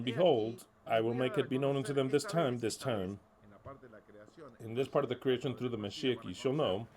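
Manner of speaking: ordinary delivery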